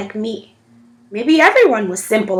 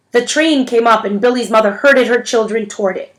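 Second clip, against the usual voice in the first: plain reading voice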